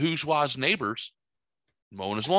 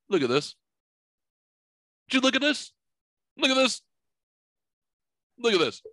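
higher, excited voice insisting someone look; hammed-up pointing it out to the neighbor, higher; Kevin Harpett impression; 9 semitones higher than usual. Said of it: "like Kevin Harpett".

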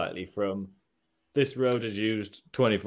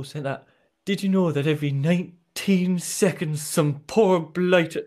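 bad Geordie accent, drawn out and louder; slow, higher, failed Geordie impersonation slipping towards Irish; attempted Geordie accent; 5 semitones higher, 4 dB louder, slower than usual. Shown as a demonstration. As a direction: have a clumsy go at Geordie. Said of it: "let me try and do the accent".

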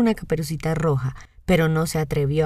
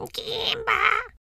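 voz ronca de lobo; 3 dB quieter than usual. A narrator's reading. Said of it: "la ronca voz del lobo".

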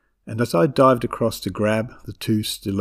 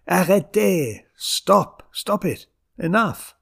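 high, frantic yelled commands; yelling; 8 semitones higher, slower than usual. A narrator's reading. Grandmother in a frenzy at the kids, yelling. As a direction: yell at them to stop, pitch way up. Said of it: "She's yelling".

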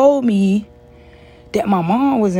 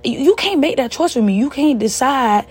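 higher-pitched acted-out objection; retold indignant protest; 6 semitones higher than usual. Daughter objecting to mom hiding her hospital stay, retold indignant protest. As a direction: re-enact pleading your case, pitch up.

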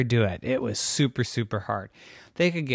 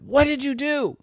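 high-pitched maternal disbelief; startled mom; 11 semitones higher than usual. Acted. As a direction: question the kids in mock shock. Mommy walks in on mess.

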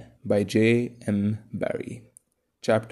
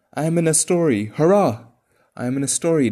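put-on high, loud childish delight; jubilant, excited announcement; thrilled exclamation; 6 semitones higher, 7 dB louder, faster than usual. A narrator's reading. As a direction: crow with joy, as if cheering.

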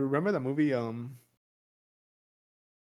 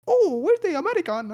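high, loud mock Mexican maid accent; botched Vietnamese accent landing as a Mexican maid; 6 dB louder than usual. Podcaster imitating Vietnamese soldiers hunting the American, mock foreign accent. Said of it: "sound like a fucking Mexican maid".

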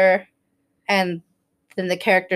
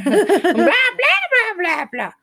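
high, mocking babble through laughter; high comic blah-blah, breaking into laughs; child reading aloud; 10 semitones higher, slower than usual. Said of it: "read quieter".

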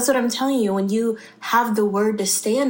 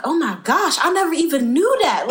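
high-pitched, acted astonishment; amazed discovery; 6 semitones higher than usual. Believer discovering something new in his word, acted amazement. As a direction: exclaim it as a sudden revelation, voice lifted.

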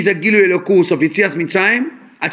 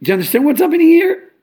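emphatic rhetorical question; 8 semitones higher, 3 dB louder, faster than usual. Real, not acted. Rhetorical challenge to the audience.

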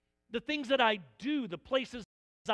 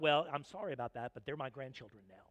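softer, fast and offhand; unbothered grandparent; 8 semitones lower, 5 dB quieter, faster than usual. Retold or imitated. Grandparent answering the upset parent, dry and offhand. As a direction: shrug it off lightly.

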